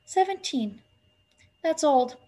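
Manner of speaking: mildly hopeless, falling "Seventeen"; high girl's voice, understated dismay. A narrator's reading. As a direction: let it drop, a little dismayed. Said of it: "said Winnie hopelessly".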